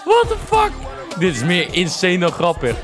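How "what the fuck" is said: shocked. Real, not acted. Shocked reaction to clip.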